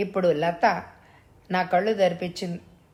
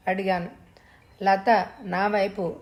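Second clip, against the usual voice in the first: ordinary delivery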